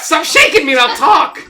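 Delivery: high, loud squawked protest; shaken chicken. Acted. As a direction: plead like a manhandled hen. Voices the shaken chicken pleading.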